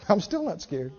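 mock unafraid. Preacher voicing the believer again, soft offhand bravado. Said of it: "You go".

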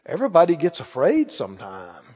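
subdued imitation of a hesitant churchgoer; doubting Christians. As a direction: mimic a fellow believer gently making excuses. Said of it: "all kind of Christians that'll try to tell you".

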